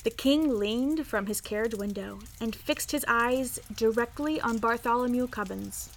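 ordinary delivery

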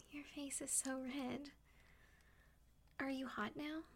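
ordinary talk, nothing marked

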